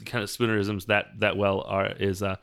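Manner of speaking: usual speaking voice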